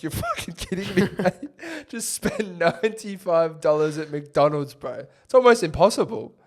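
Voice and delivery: high, laughing mock outrage; mate quoted at the drive-through, laughing and high; laughing disbelief. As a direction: imitate him cracking up in disbelief. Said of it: "Rambo goes".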